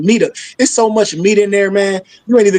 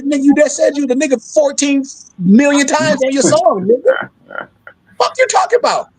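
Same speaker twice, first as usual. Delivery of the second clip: builds higher and higher into an incredulous outburst; rising heated retort; 6 semitones higher than usual. Acted. Answering the European who denies the word, rising incredulous retort. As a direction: climb in pitch as the outrage grows.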